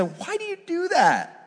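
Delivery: high-pitched disbelieving whine; baffled question. Acted. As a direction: ask it high, as if baffled by an animal. Baffled question put to animals.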